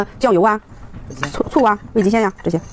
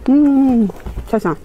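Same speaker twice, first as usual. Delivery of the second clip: chewing while speaking; muffled through food; mouth full; 4 semitones higher, much slower than usual. Real, not acted. Seen 1: No chopsticks; she chews with pursed lips, holding bones.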